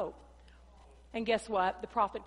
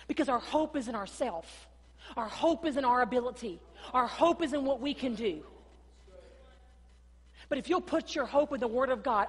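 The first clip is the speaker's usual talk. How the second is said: higher and louder, building into a passionate preacher's cry; building sermon climax; 6 semitones higher, 9 dB louder than usual. Acted. Pastor urging the church to trust God, building to loud fervor. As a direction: lift the church up with rising fervor.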